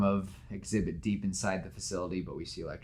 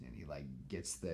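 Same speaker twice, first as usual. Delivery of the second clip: usual speaking voice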